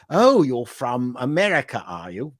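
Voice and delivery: mock British assumption, dropping off at the end; British accent. Brit guessing the comic is American, mock British accent. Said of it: "whenever I did tours of the, uh, U K".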